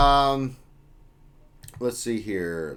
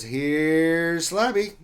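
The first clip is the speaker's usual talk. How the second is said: slow, higher, louder character voice; put-on voice; 7 semitones higher, 6 dB louder, much slower than usual. Streamer voicing a viewer's chat message, slow and put-on. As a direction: drag it out like a puppet introducing itself.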